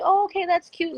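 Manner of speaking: high, delighted little voice; high, charmed mock reaction to the maid. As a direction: sound pleasantly surprised and charmed. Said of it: "but I was like".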